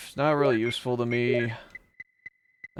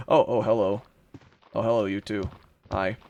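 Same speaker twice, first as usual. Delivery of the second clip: ordinary delivery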